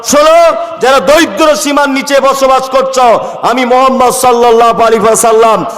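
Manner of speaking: high, ringing proclamation; full-force preaching. Acted. Head of state pledging care to widows and orphans, high impassioned oratory. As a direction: declaim it like a ruler addressing the people.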